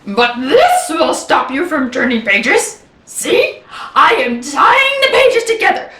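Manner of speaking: cartoon monster explaining, swooping in pitch; Grover, insistent. Grover ties pages to stop the reader, growing alarm.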